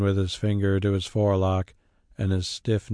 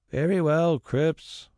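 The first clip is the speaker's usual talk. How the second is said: despairing; 7 semitones higher, slower than usual. A narrator's reading. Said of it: "said the squire in despair".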